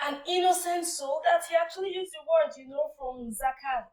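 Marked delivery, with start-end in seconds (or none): 0.0-1.2 higher and louder, aghast at the killing; appalled outburst; horrified protest. Retold or imitated. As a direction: cry out in shocked accusation. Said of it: "Musa's response again was like".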